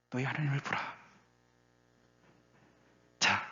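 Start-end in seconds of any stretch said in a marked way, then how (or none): 0.1-0.8 softer, hushed repeat; quiet restatement. Acted. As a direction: let it drop softly after the cry. Quiet restatement after loud proclamation.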